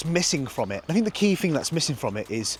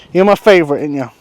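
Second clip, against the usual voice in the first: cooing to horse; 2 semitones higher, 11 dB louder than usual. Real, not acted.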